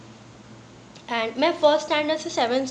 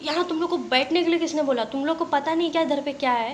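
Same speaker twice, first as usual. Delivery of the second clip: mock teacher scolding; 4 semitones higher than usual. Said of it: "हम लोग को डांटने लगे".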